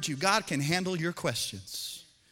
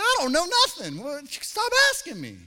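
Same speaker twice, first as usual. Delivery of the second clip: exasperated dad; 8 semitones higher, 6 dB louder than usual. Retold or imitated. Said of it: "I'm just like".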